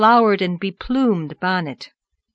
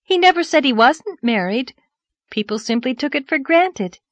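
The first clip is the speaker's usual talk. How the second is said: higher-pitched earnest defence; trying to be fair; 6 semitones higher, 4 dB louder than usual. A narrator's reading. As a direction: explain it fairly in his favour. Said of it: "trying to be just".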